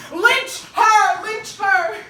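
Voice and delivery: loud, high, forceful yell; mob shout. Acted. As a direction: shout it like an angry lynch mob. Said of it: "Somebody started shouting".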